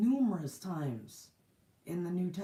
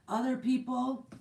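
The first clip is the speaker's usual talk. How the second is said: ordinary talk, nothing marked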